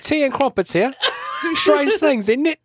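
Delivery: put-on high, loud, hammy British accent; posh Brit gag, shouted. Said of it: "never done anything with British".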